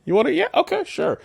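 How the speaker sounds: very high sneering voice; mock him way up in pitch; high mocking Emperor.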